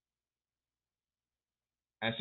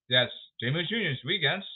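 ordinary talk

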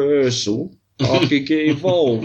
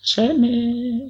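high, drawn-out call; lingering high-pitched summons; playful calling out; 12 semitones higher than usual. Pretend. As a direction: sing the name out high and long. Calling the producer's name playfully.

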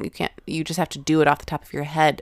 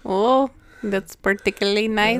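higher-pitched Irish lilt; mock Irish; 5 semitones higher than usual. Co-host playing along with the teasing, mock Irish. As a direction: put on a playful Irish accent. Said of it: "it's, like, kinda Irishy".

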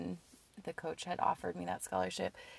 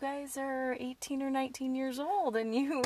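imitating dad; 6 semitones higher than usual. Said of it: "he's like".

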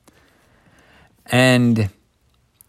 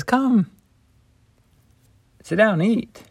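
much higher, light and welcoming; inviting Jesus; 11 semitones higher than usual. Acted. Jesus invites the disciples to eat, much higher and welcoming. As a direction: call them in gently to breakfast.

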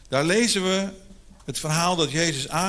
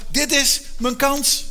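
determined thought; 8 semitones higher, 3 dB louder, slower than usual. Retold or imitated. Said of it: "Maar die man dacht".

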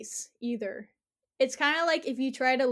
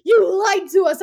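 louder, higher mock-angry accusation; 7 semitones higher, 12 dB louder than usual. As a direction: snap it at the liar. Disguised villains accuse her.

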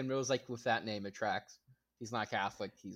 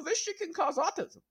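mock anti-vaxxer; 11 semitones higher than usual. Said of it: "famous for, like, being, like".

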